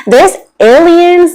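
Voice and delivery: high, loud, slow mock-dramatic announcement; excited reveal. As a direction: blurt out the big news with glee. Repeats whispered news aloud.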